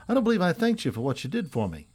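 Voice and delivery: a hint of a dandy putting on airs; mildly mannered, genteel thanks; affected young dandy. A narrator's reading. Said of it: "an affected manner of speaking".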